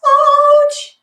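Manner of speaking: high mock yelp of "ouch"; voicing sore thighs. Instructor voices the class's aching thighs, high mock yelp. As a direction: squeak "ouch" like a sore muscle. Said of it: "Are they saying anything?".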